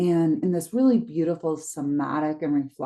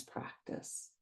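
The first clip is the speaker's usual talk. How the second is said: whisper; 22 dB quieter than usual. Acted. Meditation, word breathed out.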